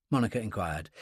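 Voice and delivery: ordinary delivery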